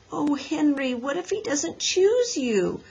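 worried, high-pitched question; fearful. A narrator's reading. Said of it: "asked Beezus fearfully".

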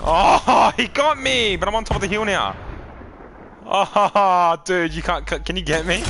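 high, loud, laughing panic; panicking gamer. Real, not acted. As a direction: yelp and laugh while dying. Getting shot in game.

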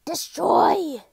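booming villain order; destroy shout. Acted. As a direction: bark it like a monster queen. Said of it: "that destroy is not very sounding natural".

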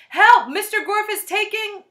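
high, loud cry for help; desperate schoolgirl shout. A narrator's reading. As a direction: yell urgently, cut off mid-sentence. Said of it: "shouted Allison".

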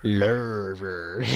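low, stretched "Lurver" for lava; mimicked pronunciation. Teasing a friend's way of saying it, mimicked pronunciation. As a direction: draw out "Lurver" in a mock deep voice. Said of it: "it's just funny how you say it".

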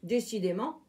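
ordinary delivery

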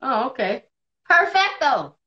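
ordinary delivery

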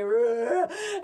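loud mock bellow; hammed-up animal growl-roar; imitated gorilla roar.

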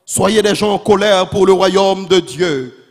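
loud, driving sermon delivery; full-force preaching. Real, not acted. Preacher refusing to compromise the truth, full-force preaching. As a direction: proclaim it forcefully, without letting up.

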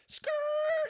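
very high tire screech noise; mock screech. Chariot braking in front of Chance, high screech sound. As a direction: screech like brakes.

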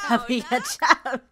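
words breaking into a laugh; mad laughter. Acted. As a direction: act so livid that you crack up laughing. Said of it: "you're so mad that you just start laughing".

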